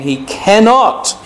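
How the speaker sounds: cannot drawn out high and slow; stress on cannot. Shown as a demonstration. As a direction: lean hard on cannot. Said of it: "let me emphasise that word".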